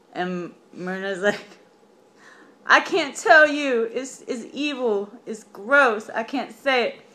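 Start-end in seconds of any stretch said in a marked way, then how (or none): 2.7-7.0 put-on higher and louder, upset young girl. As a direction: pitch up into a fretful girl refusing to tell. Girl voiced in retold myth.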